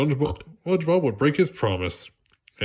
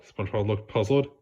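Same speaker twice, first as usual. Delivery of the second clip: plain reading voice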